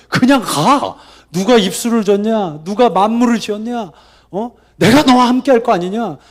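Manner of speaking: 높고 큰 소리로 다그치는 호통; 하나님이 화나서 몰아붙이듯, 높게; 화내시는 하나님. Acted. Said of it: "주님이 나중에는 막 화를 내시죠". (화가 나서 호통치듯)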